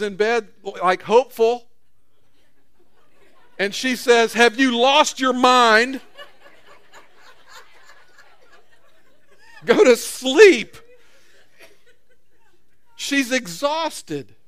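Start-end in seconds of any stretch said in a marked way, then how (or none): 4.3-10.8 loud, slow mock outburst with a laugh; exasperated wife. Wife turning down her hopeful husband at bedtime, loud mock exasperation. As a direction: play the worn-out wife snapping back, half laughing.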